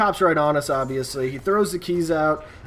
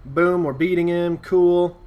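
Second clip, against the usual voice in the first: ordinary talk, nothing marked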